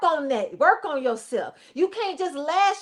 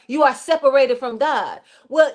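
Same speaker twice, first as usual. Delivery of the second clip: normal talking voice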